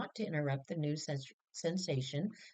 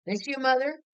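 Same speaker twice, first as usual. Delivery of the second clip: much louder and higher, calling down the line; loud phone call; 6 semitones higher, 8 dB louder than usual. Acted. Henry calling Mother long distance, loud.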